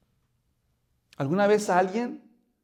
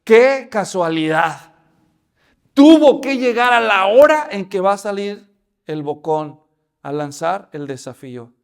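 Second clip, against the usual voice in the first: ordinary talk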